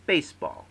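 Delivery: "baseball" high and loud, both "base" and "ball" stressed; two stressed syllables. Shown as a demonstration. Teacher demonstrating a spondee, "baseball" stressed on both syllables. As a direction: give weight to both halves of "baseball". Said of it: "consists of two stressed syllables".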